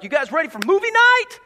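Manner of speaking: high, loud, bright-eyed parent call; cheery parent. Retold or imitated. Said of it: "you're like".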